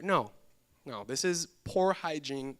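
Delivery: ordinary talk, nothing marked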